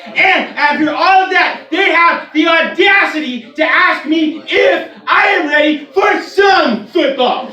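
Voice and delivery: high, slow, shouted hype; slow roaring football intro; building announcer shout. Retold or imitated. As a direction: bellow it like a stadium hype man. Climax of announcer impression.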